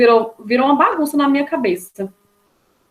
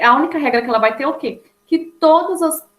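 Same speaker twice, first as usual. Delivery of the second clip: normal talking voice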